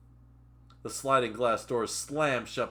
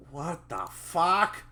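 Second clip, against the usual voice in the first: slow, slightly raised character voice; first try at character voice; 6 semitones higher, slower than usual. Acted. Reader trying the character's curse, lightly acted drawn-out voice. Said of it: "That's his voice".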